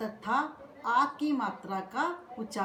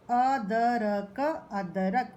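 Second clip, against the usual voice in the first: अदरक said very slowly, syllable by syllable, twice; very slow word; much slower than usual. Shown as a demonstration. Teacher slowly modelling अदरक for pupils. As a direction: stretch अदरक out slowly for learners. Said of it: "चार वर्णों के शब्दों का उच्चारण".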